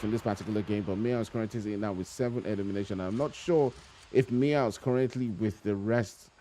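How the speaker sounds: brisk esports caster commentary, running call of the action; live play-by-play. Acted. Caster calling the match for stream viewers, brisk play-by-play. Casting a battle royale match.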